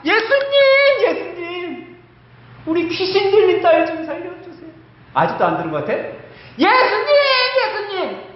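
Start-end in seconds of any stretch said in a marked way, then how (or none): 0.0-4.7 crying desperate cry. Acted. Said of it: "다시". 6.6-8.3 high, loud repeated cry; calling out desperately. Acted. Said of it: "소리를 계속 질렀을 거야".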